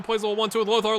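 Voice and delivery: ordinary talk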